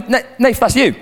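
high, animated quote of a dad rumbling a prank; a touch of amused recognition, high-pitched; dad catching on. Retold or imitated. Acted dad seeing through prank call.

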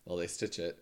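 ordinary talk

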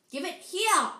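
high, loud, angry demand; yell. A narrator's reading. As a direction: yell at him to hand it over. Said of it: "Harry yelled".

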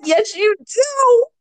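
high, drawn-out fangirl reply; mock swooning. Starstruck teen answering the actor's accent offer, mock high swoon. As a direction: stretch it out high, hammed-up and smitten.

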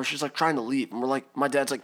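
ordinary delivery

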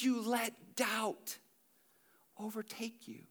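soft, drawn-out tender lament; tender, not condemning. Acted. Jesus to Peter, so close to getting it, tender. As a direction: sigh it slowly, fading down to a whisper. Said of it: "That's not how he said it".

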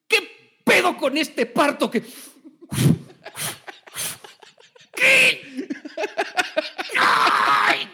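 high, loud, laughing birth scream; mock labor cries. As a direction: play the labor pains hammed-up.

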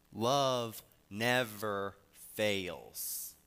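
very slow and loud, leaning on love; drawn-out proclamation. Acted. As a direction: let each word ring out. Climax of love sermon.

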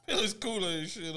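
repeating it through laughter; mock-quote while laughing. Repeating friend's line laughing.

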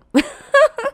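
sudden shrieking laugh burst; loud high laugh. Real, not acted. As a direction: crack up loudly.

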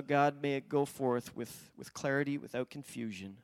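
low, soft, reverent praying voice; prayer. Real, not acted. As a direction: pray quietly and earnestly, pitched well down. Leads a prayer.

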